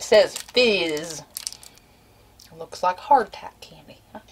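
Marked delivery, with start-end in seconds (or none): 2.5-4.2 whispered reading. Real, not acted. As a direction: read it under your breath. Reading the package.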